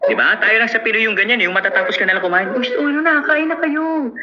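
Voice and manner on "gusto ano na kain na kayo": a high, coaxing invitation to eat; pushy Filipino host. Retold or imitated. Host urging guests to keep eating. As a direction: press the guests to eat, much higher than your own voice.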